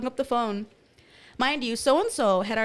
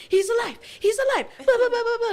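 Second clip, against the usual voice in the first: high, loud mock hysteria; freaking out; 12 semitones higher, 6 dB louder than usual. Stoned girl telling her sister, freaking out. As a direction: blurt it out in a high panic. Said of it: "I'm just freaking out".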